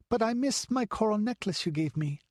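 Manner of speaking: put-on a child explaining eagerly; little girl. A narrator's reading.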